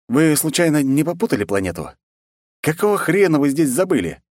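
would-be commander's stern demand; attempted menacing voice. A narrator's reading. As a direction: try to sound fierce without quite managing it. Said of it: "говорил своим самым грозным голосом".

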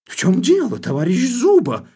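high, loud, furious cry; enraged shout. Acted. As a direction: flare up and yell at him. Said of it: "мгновенно остервенев, вскричал".

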